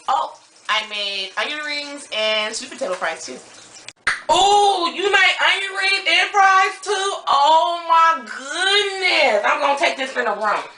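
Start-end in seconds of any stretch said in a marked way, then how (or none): 4.3-10.6 high, loud sassy cousin. Acted. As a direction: play a brash cousin from the Bay. Cousin excited about food.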